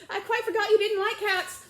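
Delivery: high, loud eager apology; high girlish apology. Acted. Alice apologising to the mouse, high and eager.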